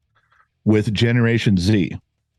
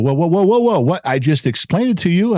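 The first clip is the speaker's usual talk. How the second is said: higher, mock-startled teacher protest; flabbergasted teacher; 6 semitones higher than usual. Teacher to class who won't follow, higher mock disbelief. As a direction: act the teacher thrown off balance. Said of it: "the teachers are flabbergasted".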